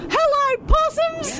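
very high campy drag voice; Dame Edna. Acted. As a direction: coo like a grande dame. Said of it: "We're all gonna do a Dame Edna".

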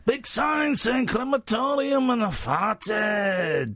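slow, high Scottish comic wail; Billy Connolly. Acted. Host as comedian confessing on stage, high drawn-out Scottish impression. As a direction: stretch the words out, raucous Scottish raconteur. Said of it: "Billy Connolly back again".

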